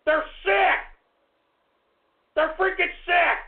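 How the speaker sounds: high-pitched angry ranting; disgusted tirade. Real, not acted. Host condemning trolls as perverts, disgusted ranting. Host rails at prank callers.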